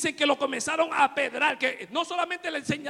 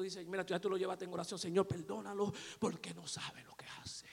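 soft whisper, lower and quieter; hushed whisper; 7 semitones lower, 14 dB quieter than usual. Acted. Preacher hushing the congregation to picture peaceful sleep. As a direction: hush it like someone sleeping.

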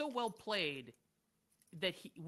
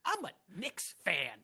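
puffed-up fan voice, a bit louder; mocking Rapaport; 4 dB louder than usual. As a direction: boast like a brash Knicks fan. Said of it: "He does that thing".

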